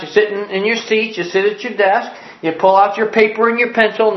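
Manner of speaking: ordinary delivery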